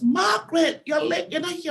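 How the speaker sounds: high-pitched, indignant telling-off; scolding boss. Retold or imitated. Boss to Margaret who slept in late, scolding.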